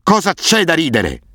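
the roar climbs into a crazed high shriek; furious roar. A narrator's reading. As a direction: scream it out, losing all control. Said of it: "ruggiva a ogni colpo".

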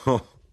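high, loud one-note chuckle; acted short laugh. A narrator's reading. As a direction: laugh once, briefly. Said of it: "Io di nuovo risi".

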